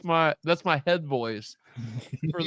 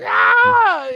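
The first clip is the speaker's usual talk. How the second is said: high loud mock scream; hammed-up labour cry, high and loud; 9 dB louder than usual. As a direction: yelp like a woman in labour. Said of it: "you're like".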